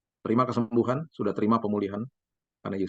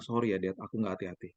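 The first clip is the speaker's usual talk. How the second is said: tearful apology; 4 semitones lower, 8 dB quieter than usual. Retold or imitated. Crying son apologizes.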